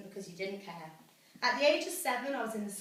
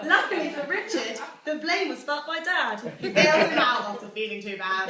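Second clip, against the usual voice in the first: laughing; 3 semitones higher, 7 dB louder than usual. Real, not acted. Reading joke, laughing.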